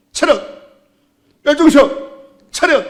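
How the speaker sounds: barked high military commands; drill sergeant. Retold or imitated. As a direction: bark it across the parade ground. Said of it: "군대 가서 매일 들었던 얘기". (군대 구령 외침)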